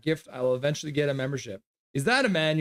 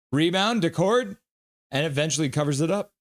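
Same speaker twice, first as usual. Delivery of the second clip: ordinary talk, nothing marked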